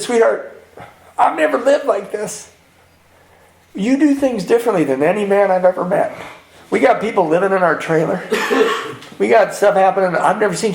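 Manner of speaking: high-pitched, tender wife's voice; imitating loving wife. Voices his loving wife reassuring him.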